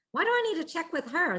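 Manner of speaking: high, quick retold objection; indignant protest. Organizer protesting to herself about needing permission, indignant. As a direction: object fast, pitch jumping up.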